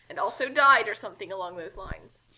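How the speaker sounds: ordinary talk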